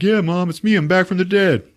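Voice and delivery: high, lightly mocking hero voice; mock Superman to mom. Superman greets his crying mom, high mock cheer. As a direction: chirp it up high like a goofy son.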